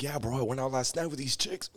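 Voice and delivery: mock party-bro brag. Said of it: "he's like".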